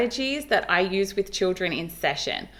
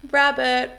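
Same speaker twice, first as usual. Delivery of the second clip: big gasped breath, then "rabbit" slightly higher; 4 semitones higher than usual. Shown as a demonstration. Therapist showing the big breath before rabbit. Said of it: "So I'll do big breath".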